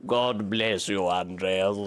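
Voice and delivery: put-on low, slow voice of an elderly monk; a monk at slow, low pitch; old monk voice. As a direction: give the blessing slowly, low and grave. Voicing an old monk NPC.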